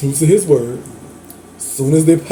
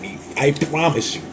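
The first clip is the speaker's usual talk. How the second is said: normal talking voice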